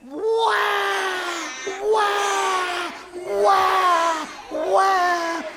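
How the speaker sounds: put-on very high, loud baby wail; screaming baby. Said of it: "and the baby went".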